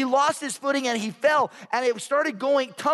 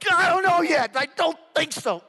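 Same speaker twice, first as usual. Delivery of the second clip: straining in pain; 7 semitones higher than usual. Retold or imitated. Hurt man answering about an ambulance, comic pained strain. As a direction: force the words out through pain, then groan.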